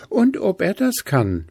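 Stachelchen contradicting. A narrator's reading. Stachelchen contradicts Gruseli, high and bristly. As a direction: bristle back at him. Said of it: "widersprach Stachelchen".

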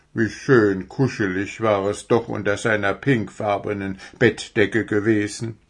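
plain reading voice

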